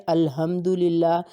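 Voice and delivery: الحمدللہ drawn out slowly with clear Arabic letters; careful recitation. Shown as a demonstration. Teacher showing Urdu speakers the Fatiha, slow careful recitation. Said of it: "الحمدللہ کو کیا پڑھتے ہیں".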